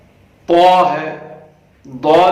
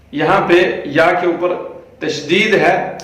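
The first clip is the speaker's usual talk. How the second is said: normal talking voice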